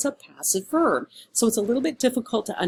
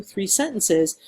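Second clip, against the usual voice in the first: usual speaking voice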